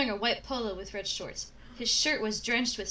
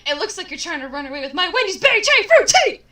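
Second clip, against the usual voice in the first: loud, higher furious snarl; angry snarl; 7 semitones higher, 12 dB louder, faster than usual. Acted. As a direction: snarl the accusation. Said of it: "he snarled angrily".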